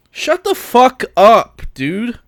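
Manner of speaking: loud, high, drawn-out exasperation; exasperated outburst. Real, not acted. Ranter telling bag defenders off, exasperated. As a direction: cut them off, slow and loud. Snaps back at imagined defenders.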